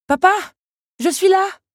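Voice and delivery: high, loud insisting call; insistent call. A narrator's reading. Belle insists to her father, calling out loud. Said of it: "insista-t-elle".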